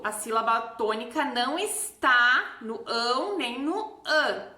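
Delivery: ordinary talk, nothing marked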